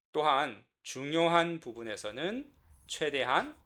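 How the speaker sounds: ordinary talk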